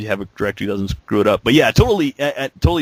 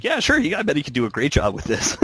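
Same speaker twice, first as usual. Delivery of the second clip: sarcastic; 4 semitones higher, 5 dB quieter than usual. Retold or imitated. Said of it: "I'm like".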